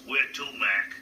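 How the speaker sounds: gruff New York cabbie asking a fare. Retold or imitated. A cab driver asks his passenger where to go, out of the side of his mouth. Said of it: "the voice came out the other, like this".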